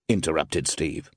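ordinary delivery, nothing marked

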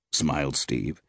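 plain reading voice

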